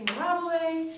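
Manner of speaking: high, stretched-out happy call; goofy cheer. Acted. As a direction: sing it out goofily. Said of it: "and you're really goofy".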